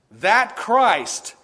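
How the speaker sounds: CHRIST loud and drawn out, then a pause; stress on CHRIST. Shown as a demonstration. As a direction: land hard on CHRIST and stop. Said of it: "did there with my tone of voice".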